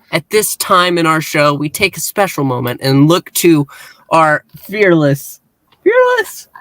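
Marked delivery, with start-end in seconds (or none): 4.1-5.4 slow dramatic. Acted. Dramatic build-up introducing Jimmy. 5.8-6.5 sudden high squeak; squeaky high. Acted. Dramatic build-up introducing Jimmy.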